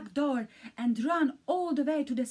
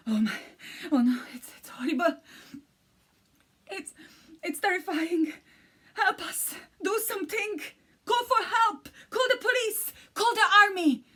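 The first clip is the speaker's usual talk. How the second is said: rising higher and louder into frantic cries; frantic pleading; 10 semitones higher, 6 dB louder than usual. A narrator's reading. Elephants beg the butler to call the police, rising frantic cries. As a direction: scream for help, getting more desperate.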